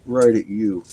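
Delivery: ordinary talk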